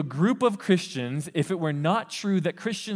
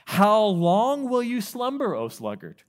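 dramatic scripture reading; 6 semitones higher, 4 dB louder, slower than usual. Acted. Preacher reading the verse aloud, slow and raised. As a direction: call it out slowly like scripture.

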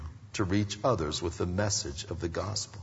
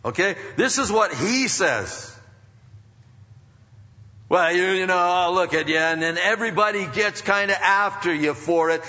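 raised, urgent sermon voice; full-force preaching; 10 semitones higher, 5 dB louder than usual. Real, not acted. Preacher defending God's word against objectors, full-force preaching. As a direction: drive it home from the pulpit, pitched high.

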